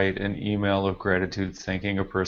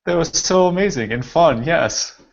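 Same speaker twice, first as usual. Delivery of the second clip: much higher and louder gushing praise; excited praise; 8 semitones higher, 8 dB louder than usual. Real, not acted. Host thanking Sean for sharing, excited gushing. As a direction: bubble over with delight.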